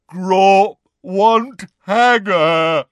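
slow, loud, high giant bellow; lumbering creature roar. A narrator's reading. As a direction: bellow the demand word by word. Giant roaring his demand.

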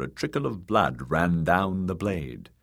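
usual speaking voice

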